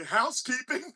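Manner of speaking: high, sing-song housekeeping call; mock hotel maid. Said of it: "knocking on the door and saying".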